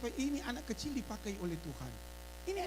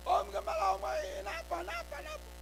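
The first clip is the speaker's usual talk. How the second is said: put-on child calling out; 13 semitones higher than usual.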